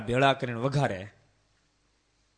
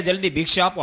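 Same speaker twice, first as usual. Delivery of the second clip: curt demand; 7 semitones higher, 8 dB louder, faster than usual. Acted. Monk curtly demands alms.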